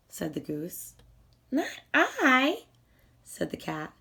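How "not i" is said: higher, airy cat voice; a vain cat waving off the request; vain cat refusing. Acted.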